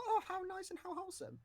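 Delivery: high, mock-delighted gush. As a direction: gush over how sweet it is. Mock delighted inner thought.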